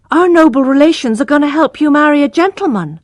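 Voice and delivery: a little brother teasing his sister; young boy. Acted. Little brother Abraham teasing Tess, light child voice.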